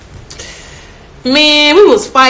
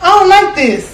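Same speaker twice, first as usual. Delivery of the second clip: much higher, slow mock whine; whiny protest; 9 semitones higher, slower than usual. Imitating herself protesting to her brother, whining. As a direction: whine it like a sulky kid. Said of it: "I be like".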